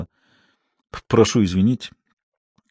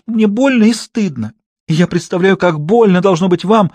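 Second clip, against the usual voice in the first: melodramatic actress; 6 semitones higher than usual. Acted. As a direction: overplay the guilt like Gertrude. Actress performing tragic repentance.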